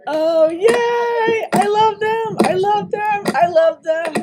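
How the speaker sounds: high, loud delighted squeal; thrilled squeal. Real, not acted. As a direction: gush over them like a thrilled fan. Fan cheering friends going live.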